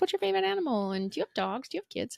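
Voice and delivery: high, soft imitated little-kid voice; lightly mimicked small children; six-year-olds' questions. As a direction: pipe up softly like a curious child.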